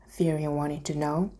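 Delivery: usual speaking voice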